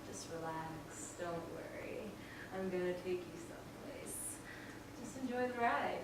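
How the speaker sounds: low, very soft coaxing voice; hushed, low and in control; dominating reassurance. Acted. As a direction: soothe them while quietly taking charge. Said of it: "type of force".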